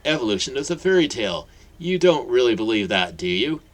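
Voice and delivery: loud fast scoff, then a challenge; knowing dismissal. Acted. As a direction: brush her science aside. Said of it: "laughed wisely".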